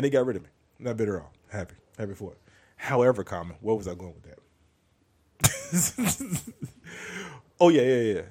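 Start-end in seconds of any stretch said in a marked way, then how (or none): 5.4-8.2 high squeaky laugh at herself. Real, not acted. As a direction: laugh off losing the thread. Laughs at losing track.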